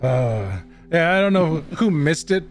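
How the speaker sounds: usual speaking voice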